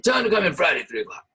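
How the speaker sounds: lightly voiced boss order; quick relayed message. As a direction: rattle it off quickly. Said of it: "He said".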